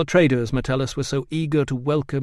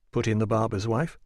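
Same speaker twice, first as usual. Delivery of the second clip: ordinary delivery, nothing marked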